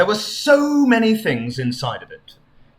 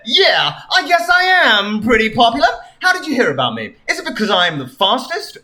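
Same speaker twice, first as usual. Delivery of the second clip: cocky Sonic; 6 semitones higher, 3 dB louder than usual. Acted. Sonic boasting.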